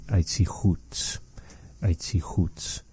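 slow, soft retelling of "aitzi hoots"; elder's soft form. Retold or imitated. Teacher imitating how the elder softly said it. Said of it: "you would hear her say".

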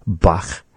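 Bach ending on a raspy back ch; German-style Bach; Bach with rasp. Shown as a demonstration. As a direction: breathe out the ch at the end of Bach. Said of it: "is the way that they say it".

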